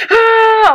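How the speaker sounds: high, loud labour wail; animal-like cry. Retold or imitated. Mother reliving a contraction, animal-like cry. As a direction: let out a drawn-out animal cry while bearing down. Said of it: "I was like an animal".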